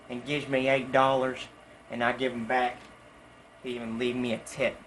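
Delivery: normal talking voice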